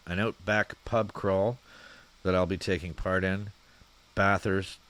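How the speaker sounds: usual speaking voice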